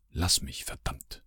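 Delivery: soft murmur fading to a whispered "verdammt"; murmured curse. Acted. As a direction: mutter it, the curse barely breathed. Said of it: "murmelte sie". (gemurmelter Fluch, am Ende nur geflüstert)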